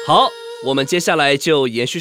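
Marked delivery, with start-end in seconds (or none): none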